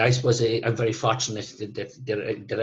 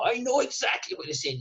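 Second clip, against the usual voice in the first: impatient; 12 semitones higher than usual. Retold or imitated. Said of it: "starting to get annoying".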